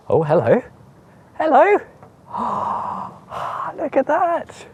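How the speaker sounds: high, gasping amazement at a lucky find; delighted surprise. Real, not acted. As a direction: greet the find with a high, astonished hello. Finds the glass fits by luck.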